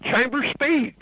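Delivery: high-pitched, insistent clarification; retold insistence. Storyteller asking the crew for Lake, insistent.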